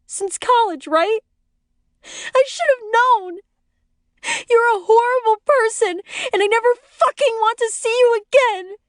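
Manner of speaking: hysterical accusation. A narrator's reading. Wife confronts friend over supposed affair.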